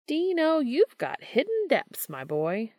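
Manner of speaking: high, slow teasing drawl on Dino; Gabriel teasing. A narrator's reading. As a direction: tease him fondly, up high.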